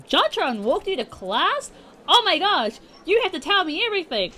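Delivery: high, loud gushing excitement; excited squeal. Acted. Mai hears JonTron walked Hana, high loud excitement. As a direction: gush like a fangirl.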